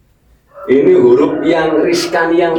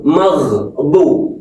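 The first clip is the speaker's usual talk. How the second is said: ordinary talk, nothing marked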